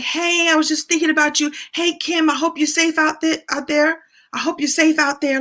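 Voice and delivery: high-pitched, friendly phone-message imitation; imitated well-wishers. Long-lost friends texting to check in, imitated in a high voice. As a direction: mimic an old friend checking in.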